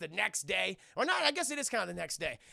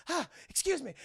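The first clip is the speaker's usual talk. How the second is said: higher-pitched mock excuse-me; acted-out exclamation, pitched up; exasperated passerby; 7 semitones higher than usual. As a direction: voice a put-upon man pushing past. Voices Tucker passing people on stairs.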